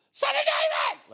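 desperate scream, high and loud. Acted. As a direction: cry out even harder for mercy. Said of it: "he cried out all the more".

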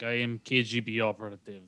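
understated imitation of a Russian agent; Russian-accented operative, played quietly. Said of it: "she puts on the accent".

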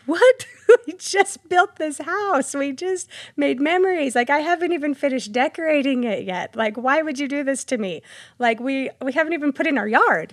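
high, loud, retold tearful protest; betrayed and heartbroken. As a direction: plead with rising hurt, then settle. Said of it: "And I remember I cried".

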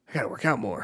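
a lightly voiced superhero talking to himself; Mister Incredible. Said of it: "pretty solid little impression".

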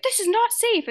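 put-on high loud child scolding; squeaky safety nag; imitating a little sister, high; mock bossy kid. D W imagined warning.

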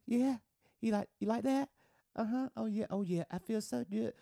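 mock a woman's awkward bedroom talk; imitated woman. Imitating woman's forced dirty talk.

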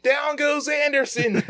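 high, drawn-out commentator call; shouting announcer. Retold or imitated. Podcaster mimicking announcer calling a baseball brawl, mock shouting. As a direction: imitate an announcer's excited knockdown call, stretched high.

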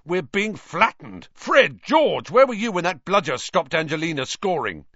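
high, loud, exasperated scolding; frustrated captain. A narrator's reading. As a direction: berate the twins for failing. Captain berating his beaters.

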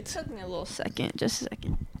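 whispered, fast and soft; quiet whisper. Real, not acted. Embarrassed friend admitting the joke took time, whispered. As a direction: mumble it under your breath.

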